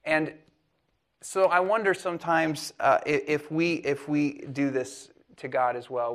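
ordinary talk, nothing marked

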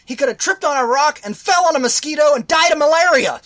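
high, loud outraged yelling at the movie; shouting in disbelief at a film's ending; furious rant. Retold or imitated. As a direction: rage at the screen like it insulted you. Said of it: "this is what triggers Eric".

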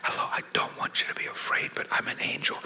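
soft breathy whisper, barely voiced. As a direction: whisper gently so as not to scare. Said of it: "creeping up on me slowly and whispering".

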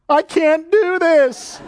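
high, loud, slow mock panic of a parent; mock panicked parent. Preacher to congregation, parent's mock panic, high and loud. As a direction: play the frightened parent for a laugh. Parents' fearful aside, laughing.

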